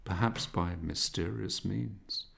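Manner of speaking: usual speaking voice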